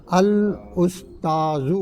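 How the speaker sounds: الاستاذ drawn out slowly, syllable by syllable; slow careful Arabic reading. Shown as a demonstration. Teacher to class, reading الاستاذ slowly. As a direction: spell out الاستاذ slowly for the students. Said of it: "الاستاذ بولتے استاد".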